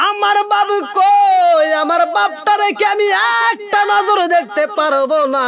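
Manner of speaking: high, loud chanted cry; crying child's wail. Acted. Crying boy begs mother to see father, desperate wail. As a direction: wail the question in desperate longing. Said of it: "কান্দার কয়".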